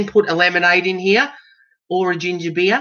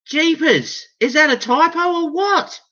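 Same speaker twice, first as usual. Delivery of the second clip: high incredulous; 11 semitones higher than usual. Real, not acted. Reacting to the typo to viewers, high and incredulous. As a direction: voice jumps up high.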